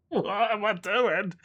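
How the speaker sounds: drawn-out, high, laughing disbelief; laughing at oneself. Real, not acted. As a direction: laugh helplessly at your own silly acting. Cracks up at own accent.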